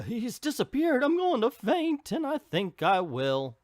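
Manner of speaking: high, hammed-up swooning gasp; fainting librarian. Reviewer voicing a stunned librarian, mock swooning. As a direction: play a dizzy librarian about to faint.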